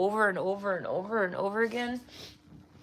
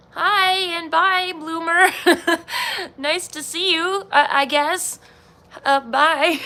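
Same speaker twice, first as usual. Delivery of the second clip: loud awkward greeting; 6 semitones higher, 6 dB louder than usual. Real, not acted. Greeting someone passing by.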